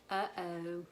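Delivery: hushed pretend "uh-oh"; mock dismay. Storyteller shows children pig is missing, hushed mock dismay.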